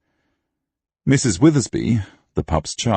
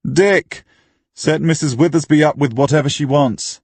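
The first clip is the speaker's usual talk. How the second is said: raised, high call across to the bar; 8 semitones higher, 4 dB louder than usual. A narrator's reading. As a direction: call an order through to the barman. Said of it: "called into the public bar".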